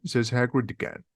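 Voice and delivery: plain reading voice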